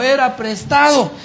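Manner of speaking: high-pitched mock lament; grito de angustia imitado. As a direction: wail it in retold dismay. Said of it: "Y gritó y dijo". (lamento agudo imitado)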